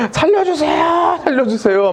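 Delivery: high, strained wail of a scream, fading on the second; patient wailing at the army dentist to be saved; screaming for help. Retold or imitated. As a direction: beg for your life in the dentist's chair. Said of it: "소리를 지르고 막 대성통곡하면서".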